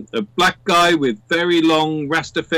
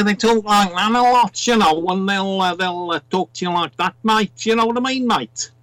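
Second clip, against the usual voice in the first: higher-pitched Scouse impression; Liverpool accent; 5 semitones higher than usual. Shown as a demonstration. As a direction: put on a thick, rapid Scouse accent. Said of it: "which is very near to Liverpool".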